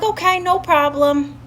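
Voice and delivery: high, put-on agreeable reply; fake breeziness. Answering the friend who told on him, falsely breezy. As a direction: pitch it up, falsely fine with it.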